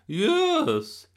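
very high, loud, thrilled yes; excited. A narrator's reading. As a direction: shriek it with delight. Thrilled Gran confirms the royal invitation.

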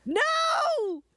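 high wailing mock cries of no; hammed-up anguish over a real Clownstown; dramatic no. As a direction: wail in hammed-up despair.

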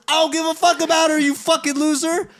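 high, loud, fast mock outburst; angry retort. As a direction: fire back loudly, pitch way up, re-enacting the spat. Retelling his angry retort.

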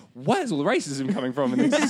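high, loud laughing exclamation; loud disbelief. Retold or imitated. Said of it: "it's like".

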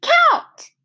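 put-on very high, loud little-kid shout; toddler shout. A narrator's reading. Fudge shouting at the dentist, very high. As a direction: yell it like a bossy toddler. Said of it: "Fudge shouted".

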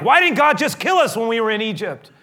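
shouting blame at the leader; angry outburst. Retold or imitated. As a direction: hurl the accusation, shouting. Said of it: "throwing off things like".

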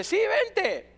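put-on high, eager call up to his kid; boyish, high-pitched careless invitation; immature dad. Reckless father calls his little boy down into the well, immature eagerness. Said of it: "era tan inmaduro como él".

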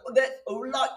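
high, loud, garbled Scottish brogue; bad Scottish accent. Shown as a demonstration. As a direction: badly fake a Scottish accent, laughing at it. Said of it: "do my Scottish accent, it's really bad".

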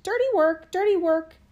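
high, loud duck squawk; crying duck. A narrator's reading. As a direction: cry it out like a disgruntled duck.

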